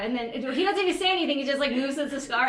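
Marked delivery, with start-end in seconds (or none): none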